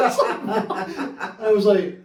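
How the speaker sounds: real laughter mid-anecdote; laughing. Real, not acted. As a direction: crack up while telling it. Laughing at his own story.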